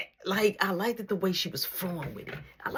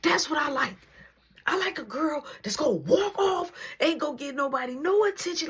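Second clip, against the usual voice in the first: much higher and louder, thrilled; delighted inner voice; 12 semitones higher, 4 dB louder than usual. Retold or imitated. His thoughts when she says she ignores harassers, delighted inner voice. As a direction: gush it like a secret win.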